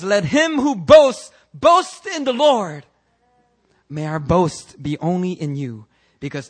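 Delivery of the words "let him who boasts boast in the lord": high, loud, slow proclamation; emphatic scripture quote. Real, not acted. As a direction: proclaim the verse in prayer. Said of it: "As the scripture says".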